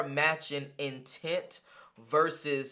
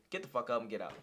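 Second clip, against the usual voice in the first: ordinary talk, nothing marked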